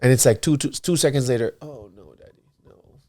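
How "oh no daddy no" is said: very soft, hushed child imitation; backing-out child. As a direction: shrink back like a suddenly unsure kid, mimicked. Father voices daughter suddenly backing out.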